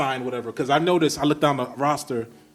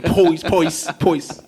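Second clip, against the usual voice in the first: poise, Brooklyn oi vowel; hammed-up Brooklyn poise; Brooklyn accent; 4 semitones higher, 5 dB louder, much slower than usual. Shown as a demonstration. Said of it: "The Brooklyn".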